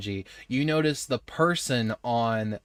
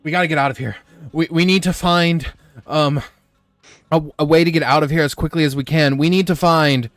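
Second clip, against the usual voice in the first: loud, rushed panicked urging; urgent shout; 4 semitones higher, 13 dB louder, faster than usual. Acted. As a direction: yell it fast in a panic. Panicked NPC urging escape.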